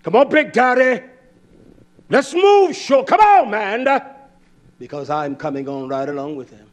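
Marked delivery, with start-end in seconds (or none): 0.0-3.0 loud, high-pitched hustling call; rallying work shout. Acted. Boss hurrying a workmate along out there, rallying shout. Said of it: "I talk like that out there". 3.0-3.9 loud, high "come on, man"; rallying shout. Acted. Boss spurring a workmate out there, rallying shout. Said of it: "I talk like that out there".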